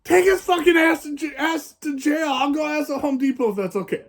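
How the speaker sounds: excited yell. Retold or imitated. Egging the officer to jail the driver, gleeful high yell. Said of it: "I was like".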